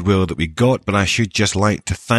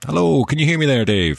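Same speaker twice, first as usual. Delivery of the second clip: higher-pitched call to someone far away; mock calling out; 7 semitones higher, faster than usual. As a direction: pretend to hail a friend overseas. Calling to colleague across in Canada.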